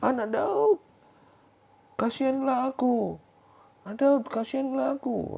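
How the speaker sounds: very high, slow, desperate shouted plea. Acted. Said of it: "semakin keras dia berteriak".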